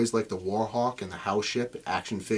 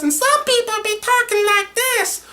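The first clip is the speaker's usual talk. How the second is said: very high, louder squeaky cartoon voice; different character voice; 7 dB louder than usual. Shown as a demonstration. The voice actor showing his range, a very high voice. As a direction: go as high as a tiny critter. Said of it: "a different dialect, a different voice".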